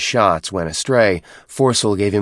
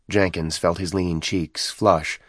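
usual speaking voice

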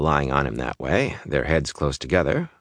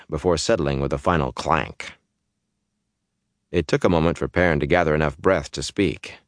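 ordinary delivery

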